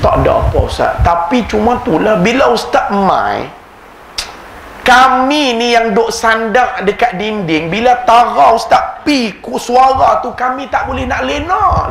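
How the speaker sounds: put-on Kedah accent. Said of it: "Saya ajuk orang Kedah".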